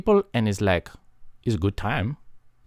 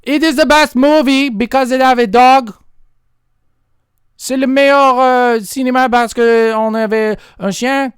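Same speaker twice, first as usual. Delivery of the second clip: hammed-up loud movie fan; overloud fan; 10 semitones higher, 10 dB louder than usual. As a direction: shout about your favourite movie. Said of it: "Why are you talking so loud?".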